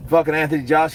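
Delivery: ordinary talk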